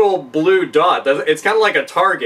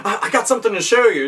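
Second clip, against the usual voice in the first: eager sales rep; 8 semitones higher than usual. Retold or imitated. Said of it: "he's just like".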